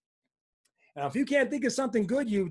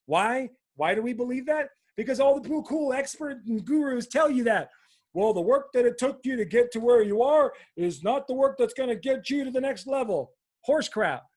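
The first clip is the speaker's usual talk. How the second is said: real fired-up rant, higher and louder; 6 semitones higher, 6 dB louder than usual. Real, not acted. Coach debunking what gurus tell you, fired up. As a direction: punch it out, worked up and indignant. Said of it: "Can you tell I'm a little fired up about this?".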